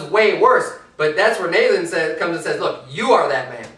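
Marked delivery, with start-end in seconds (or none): 2.6-3.7 rising rebuke. Retold or imitated. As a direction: point the finger, climbing to outrage. Nathan's rebuke, voiced by preacher.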